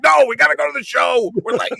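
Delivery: high, loud, frantic refusal; exasperated mock shout. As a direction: put your foot down in a comic panic. Refuses star's stop, running late.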